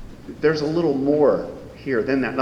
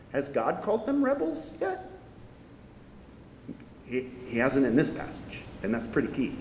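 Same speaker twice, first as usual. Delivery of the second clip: usual speaking voice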